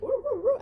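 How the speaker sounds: high, loud mock twang noise; exaggerated "woo woo" twang. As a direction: imitate twangy talk as high-pitched gibberish. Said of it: "they need to twang when talking".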